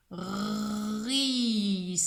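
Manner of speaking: "Ris" with long r and long final s; sounding out. Shown as a demonstration. Teacher dictates three sounds to pupil, "Ris" stretched slowly. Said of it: "Qui a trois sons".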